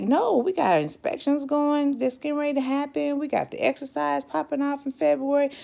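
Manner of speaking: high, loud, rushed protest against being sent home; pleading that there's no time for this; frantic protest. Retold or imitated. Protesting to commander.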